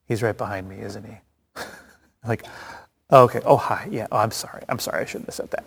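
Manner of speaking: hushed, sheepish half-whisper; embarrassed whisper. Retold or imitated. Character realizing the man is behind him, embarrassed whisper. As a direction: caught gossiping, whispering apologies.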